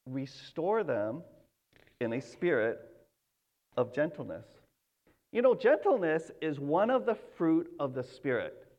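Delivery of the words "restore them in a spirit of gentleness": gentle. Retold or imitated. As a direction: soothe like a patient father. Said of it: "But the Lord says".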